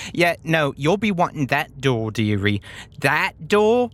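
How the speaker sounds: lightly voiced dotty brain with a British lilt; fussy character voice, correcting the listener; Rosie. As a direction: insist on it in a put-on dearie voice. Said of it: "Rosie points to the door on the right and says".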